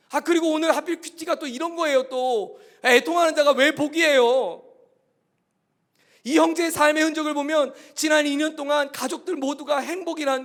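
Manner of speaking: loud, high, urgent sermon voice; impassioned preaching. Acted. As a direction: preach it with full force. Preaching at full force.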